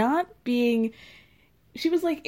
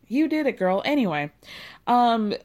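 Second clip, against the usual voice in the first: ordinary talk, nothing marked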